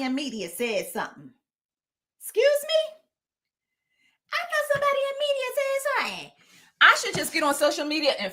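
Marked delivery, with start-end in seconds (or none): 2.2-6.3 high, fast put-on diva voice; mock sassy voice. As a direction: snap back in a high mocking voice.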